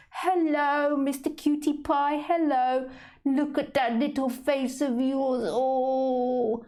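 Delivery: high-pitched, squeaky cooing at a cat; high squeaky lady voice. Acted. Tipsy woman greeting a street cat, squeaky cooing. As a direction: coo at the cat like a doting lady. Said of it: "that high-pitched, squeaky voice I tend to produce in the ladies".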